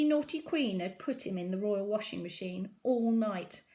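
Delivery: ordinary delivery, nothing marked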